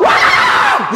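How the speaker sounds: sudden high shouted wah, as the startled wife; retold scream. Wife startled at night, high loud shout. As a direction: let out the wife's startled yell. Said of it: "tiba-tiba teriak".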